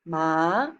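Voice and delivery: "ma" rising from mid to high; "ma" with a quick upward glide; second tone. Shown as a demonstration. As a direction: lift "ma" like asking a question. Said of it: "second tone is a high rising tone".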